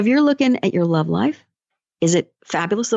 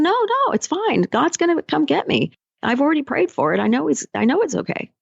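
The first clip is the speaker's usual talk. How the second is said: much higher, breezy no-no-no brush-off; stubborn refusal; 6 semitones higher than usual. Retold or imitated. Coach voicing the man turning down the motorboat, high and breezy. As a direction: wave the rescue away, oddly cheerful.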